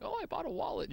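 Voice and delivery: high, innocent grandma voice. Retold or imitated. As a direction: chirp it like a pleased grandma. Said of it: "she was like".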